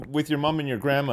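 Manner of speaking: ordinary talk, nothing marked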